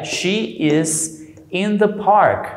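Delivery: she is in the park, each word slow and clear; slow model sentence. Shown as a demonstration. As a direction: spell it out slowly for the class. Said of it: "entonces sería". (frase modelo lenta y clara)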